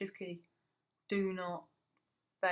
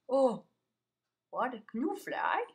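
ordinary talk

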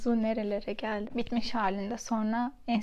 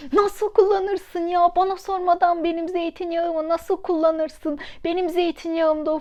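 indignant scolding, voice raised high; angry outburst; 6 semitones higher, 4 dB louder than usual. Retold or imitated. Scene: scolding her husband for using up her olive oil, angry and raised. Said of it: "titriyor sinirden".

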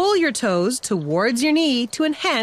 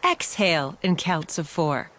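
calm exhale cue; 7 semitones lower, 4 dB quieter than usual. Shown as a demonstration. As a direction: lower and softer, easing out. Breathing cue, exhale paced.

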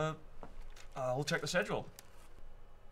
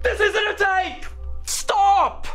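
high, loud desperate yell; louder, higher cry of protest; frantic shout; 12 semitones higher, 5 dB louder, slower than usual. Acted. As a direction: shout to stop the filming. Director protests cameras rolling.

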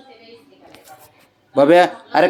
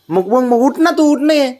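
much higher, sing-song urging; high coaxing; 9 semitones higher than usual. Real, not acted. Mother urges her boy to get up, high sing-song coaxing. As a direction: coax him up in a high, playful voice.

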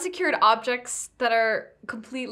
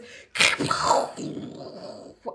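voiceless mouth-made boom; thunder blast noise. Acted. Player imitates the sound of casting Thunderwave, mouth-made blast. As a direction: imitate a blast with a long rumbling tail. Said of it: "you just hear this".

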